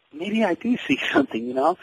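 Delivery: sheepish laugh. Retold or imitated. Boy admits to mom with a laugh that he sees it. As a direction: give in with a chuckle.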